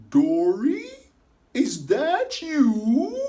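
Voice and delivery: high, loud, slow disbelieving call; surprised Destiny. Acted. Destiny voiced in story.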